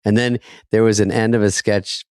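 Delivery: usual speaking voice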